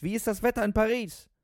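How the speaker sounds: high, loud, over-eager cry; excited shout. A narrator's reading. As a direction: holler the question in great excitement. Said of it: "schrie er aufgeregt".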